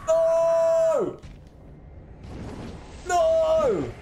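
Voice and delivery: high, drawn-out wail of defeat; dismayed shout. Real, not acted. Player who came so close, dismayed shout. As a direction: shout in despair at a near miss. Narrowly losing in the game.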